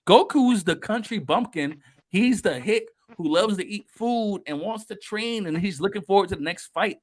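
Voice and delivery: normal talking voice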